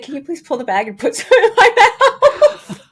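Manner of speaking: high, loud plea breaking into laughter; laughing. Retold or imitated. Runner retelling her plea to the coach, laughing. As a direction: crack up while retelling the request.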